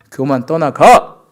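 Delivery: loud, drawn-out order to depart; commanding rebuke. Shown as a demonstration. Preacher casting pride out, commanding shout. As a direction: drive it out with a forceful shout.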